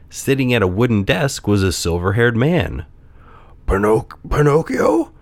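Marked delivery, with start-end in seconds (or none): 3.7-5.1 put-on halting, rising old man's question; lightly whispered disbelief. A narrator's reading. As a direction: stammer the name in mild disbelief. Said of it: "whispered the old man".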